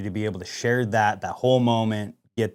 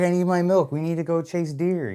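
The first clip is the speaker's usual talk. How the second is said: lightly voiced eager child, higher; pitched-up small-child quote; little son; 7 semitones higher than usual. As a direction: imitate a kid pleading with dad. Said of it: "his son".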